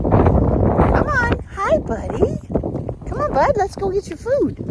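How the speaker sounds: high, sing-song pet talk. Real, not acted. Coaxing animals to their food.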